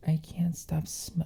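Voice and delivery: hushed, breathy whisper of delight; awed whisper. Real, not acted. Overwhelmed by the coffee scent, she whispers in awe.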